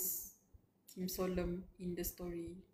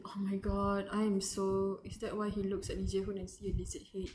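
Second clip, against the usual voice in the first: ordinary talk, nothing marked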